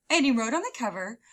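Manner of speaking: ordinary delivery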